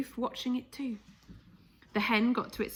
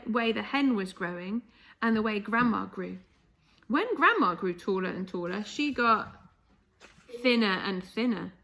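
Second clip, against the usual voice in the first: plain reading voice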